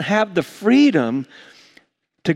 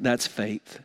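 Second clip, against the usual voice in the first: hushed repeat; 7 semitones lower, 11 dB quieter than usual. Acted. Preacher repeats point, hushed.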